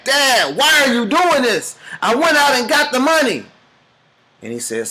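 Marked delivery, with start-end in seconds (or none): 0.0-3.5 higher, hurt protest at dad; a son's wounded outrage, raised up high; upset protest. Retold or imitated. Son protests to his dad, upset and higher. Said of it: "the son freaks out and gets mad".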